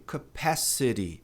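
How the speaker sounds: "Capacity" with the T tapped like Portuguese r; slow "Capacity", ending sounds like "seri"; American flap T. Shown as a demonstration. Teacher shows the American way, T softened to a tap. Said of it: "pronunciar esse T como re".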